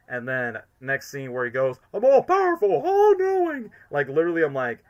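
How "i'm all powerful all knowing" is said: high, loud megalomaniac cry; Eggman. Retold or imitated. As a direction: proclaim it way up high, like a crazed villain. Imitates Eggman gloating in next scene.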